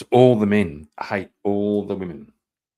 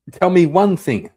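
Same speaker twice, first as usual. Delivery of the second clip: louder, higher demanding repeat; loud challenge; 8 semitones higher, 5 dB louder than usual. Real, not acted. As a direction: demand it, pushing harder.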